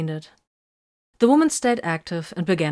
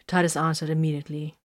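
ordinary delivery, nothing marked

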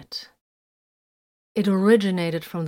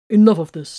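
loud, cutting in to stop the talk; sharp interruption; 11 dB louder than usual. A narrator's reading. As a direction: shut them down with force. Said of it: "Tiberius interrupted".

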